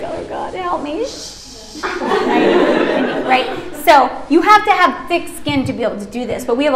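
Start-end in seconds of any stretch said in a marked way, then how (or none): 0.0-1.0 muttered plea. Retold or imitated. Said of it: "and you're just like". 1.0-1.8 faint shush. Shown as a demonstration. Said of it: "continues the demo shush".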